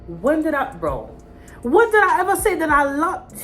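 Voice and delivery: can't believe what he said; incredulous. Real, not acted. Indignant at his claim of love.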